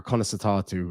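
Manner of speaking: "Conasatatu", softly, with a falling tune; Irish pronunciation. Shown as a demonstration. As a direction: demonstrate "Conasatatu" as people say it in the south. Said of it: "we would say".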